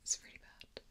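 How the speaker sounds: ordinary delivery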